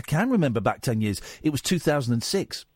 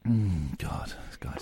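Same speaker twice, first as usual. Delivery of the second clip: muttered aside; 7 semitones lower, 7 dB quieter, slower than usual. Real, not acted. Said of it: "aside muttered off mic".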